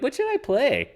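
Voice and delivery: high-pitched mock-naive question; mocking, very high imitation of a gambler; clueless gambler. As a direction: ask it high, like a clueless punter. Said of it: "be like".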